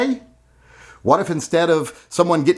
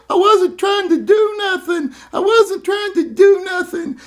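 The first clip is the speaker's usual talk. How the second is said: very high, whimpering mock plea; mock whiny protester. Mocking the protester who got knocked down, whiny and high. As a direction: mimic a scared kid pleading innocence. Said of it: "pay attention real quick to how, uh, quickly that guy went from".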